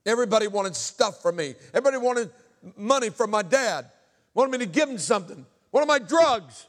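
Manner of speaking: high, very loud preaching cry; anguished shout. Real, not acted. Anguished outburst about never being loved.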